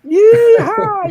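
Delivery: loud, high cowboy-style American yell; hammed-up American holler; mock American whoop. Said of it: "don't know what part of America that's".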